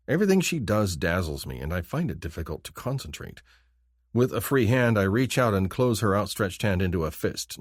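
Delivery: ordinary delivery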